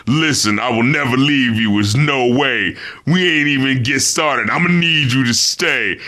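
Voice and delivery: flatly imitating a half-hearted love rap, low pitch; drab, undedicated. Host mocking a rapper's limp love song, drab delivery. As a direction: recite the love lines with no oomph. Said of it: "just the way he says it".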